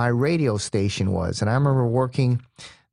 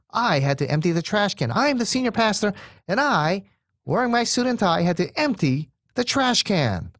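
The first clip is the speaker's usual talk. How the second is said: higher, faster mock outrage; indignant complaint; 7 semitones higher than usual. Senior pastor complaining to staff, indignant and higher. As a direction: play the offended senior pastor, pitched up.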